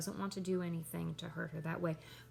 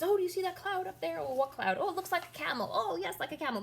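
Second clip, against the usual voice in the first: high, quick back-and-forth banter; antic disposition; 10 semitones higher, 5 dB louder, faster than usual. Acted. Hamlet teasing Polonius about a cloud, antic manner. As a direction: act out silly cloud-spotting, pitched well up. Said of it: "there's much more of the antic di-, disposition".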